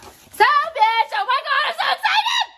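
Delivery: high, loud squeal of excitement breaking into a laugh; excited shriek. Real, not acted. Vlogger unboxing a gift for Ming, squealing with excitement.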